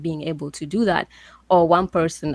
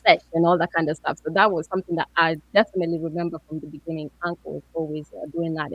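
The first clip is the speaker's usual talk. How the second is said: ordinary delivery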